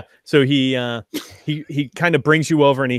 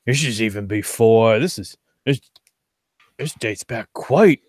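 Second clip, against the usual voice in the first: lightly voiced character, halting and pensive; musing map-reading character. Game master as a character examining an old map, musing.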